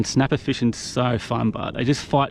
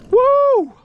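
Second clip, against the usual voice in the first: loud, high whoop; whooping at the catch; joyful whoop; 7 dB louder than usual. Real, not acted. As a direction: let out a triumphant woo. Celebrating the catch.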